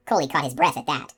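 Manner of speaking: plain reading voice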